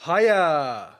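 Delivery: high, loud sing-song hiya; sing-song greeting. Real, not acted. Streamer greeting viewers at stream start, loud and sing-song. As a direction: call it out bright and high to the chat.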